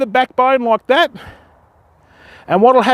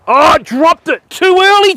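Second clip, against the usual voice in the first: real loud cry of dismay, higher; blurting out as the fish drops off; dismayed outburst; 7 semitones higher, 5 dB louder than usual. Real, not acted. Fish slips off the hook.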